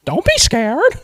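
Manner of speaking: high, loud cartoon mouse pleading; hammed-up falsetto plea; Mickey Mouse. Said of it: "talking to her the whole time".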